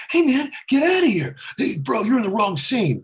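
high brash Wahlberg bark; Mark Wahlberg impression. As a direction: push him off the set like Wahlberg. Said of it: "Mark Wahlberg".

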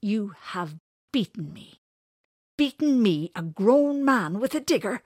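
high, loud incredulous outburst; indignant. Acted. Winters rails at being beaten, indignant outburst. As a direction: build to loud outrage.

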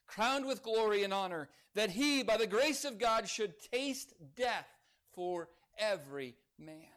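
loud, high, fervent scripture reading; rising preacher's cadence, building to a peak; preaching scripture. Acted. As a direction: proclaim it to the whole church. Preaches scripture at full force.

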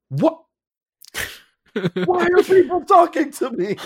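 high, bewildered "what, why are people talking to me"; stunned disbelief. Retold or imitated. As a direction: squeak it out in shocked disbelief. Baffled by celebrity followers.

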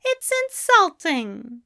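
high-pitched offended scoff; high, indignant reproach. A narrator's reading. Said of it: "The angel scoffed".

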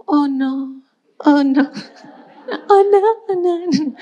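laughing, high "oh no" of mock despair; inner dismay. Shopper laughs in mock despair as the line stalls. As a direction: groan it out with a laugh.

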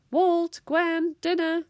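put-on high, loud call to the children; sing-out parental summons; mum calling out. A narrator's reading. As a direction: call them in for dinner from afar. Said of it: "Distracted by her mum's call".

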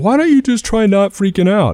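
high-pitched mocking quote of unhelpful people; clueless advice-giver. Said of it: "a lot of people are like".

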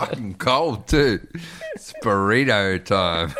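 giggly burrito announcement; laughing. Real, not acted. Laughing over cold Taco Bell.

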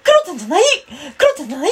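squeaky falsetto catchphrase; very high, childlike put-on voice; Kuro-chan. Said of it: "常にクロちゃんで行く". (甲高い裏声のモノマネ)

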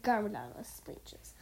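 usual speaking voice

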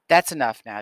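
put-on father stops the boys staring at the body; firm father. A narrator's reading. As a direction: cut them off with authority.

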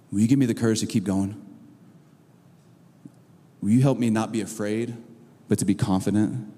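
low, subdued plea to God; humble prayer. Acted. Afraid believer asking God for courage, low earnest prayer. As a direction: pray it low, with pauses between requests. Models a frightened person's prayer.

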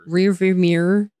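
"Rear view mirror" run together quickly; failed fast attempt. Shown as a demonstration. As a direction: rush "Rear view mirror" so the words blur into one. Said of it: "I can't say it fast".

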